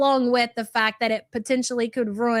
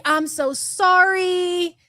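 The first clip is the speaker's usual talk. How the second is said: higher, slow, pleading mock apology; mock apologetic cheater; 6 semitones higher, much slower than usual. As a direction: ham up a sorry cheater, high and slow. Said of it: "it's usually".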